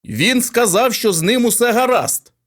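loud, high announcing policeman; loud announcement. A narrator's reading. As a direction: call it out over the crowd. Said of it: "оголосив поліцейський".

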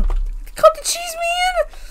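high, slow, drawn-out plea; exasperated urging. Real, not acted. Player pushing teammate to cut cheese, exasperated. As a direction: stretch it out high, pleading with a teammate.

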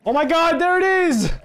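high-pitched burst of excitement; excited discovery. Real, not acted. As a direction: exclaim it high, thrilled at the find. Suddenly spots what he sought.

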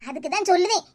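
ordinary delivery

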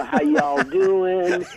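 slow, drawn-out Southern drawl imitation; Southern twang, lightly imitated; Southern accent. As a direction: mimic his mom's Southern accent, slowly. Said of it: "Mom had a Southern accent".

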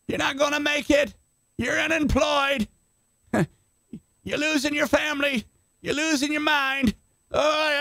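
high, relentless jeering voice of the giant; taunting mountain voice. Acted. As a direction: sneer every threat at the listener. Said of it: "shout at you".

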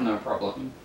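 a forced, unconvincing polite reply; softly put-on casual answer; fake-sounding. Real, not acted. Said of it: "That sounded really fake".

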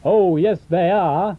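loud, high pantomime "oh yes they are"; hammed-up pantomime insistence; panto reply. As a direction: answer back like the panto dame. Pantomime call and response.

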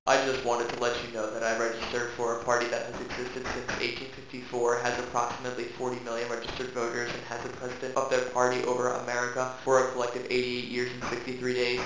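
soft, flat, factual monotone; neutral monotone. Acted. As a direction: read it like a dry fact sheet. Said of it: "Or a true neutralist".

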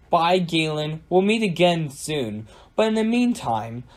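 high, taunting villain farewell; mildly wicked storybook taunt, no real cackle; cackling villain. A narrator's reading. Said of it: "cackled Susan".

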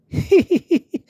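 high bright burst of laughter; cheerful high laugh. Acted. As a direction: laugh out at George's hat. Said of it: "Everyone laughs".

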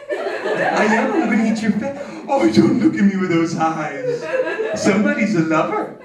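put-on high cooing baby-talk voice, laughing through it; baby talk to pets. Comedian cooing at pet store puppies in high baby talk. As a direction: gush over the puppy like it's a newborn.

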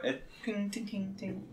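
soft tinging ricochet; ricochet sound. Acted. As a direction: mimic coin bouncing quietly. GM imitates ricochet.

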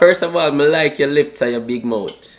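put-on Jamaican patois accent. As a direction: chat in a fake Jamaican accent. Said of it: "Stop that fake ass accent".

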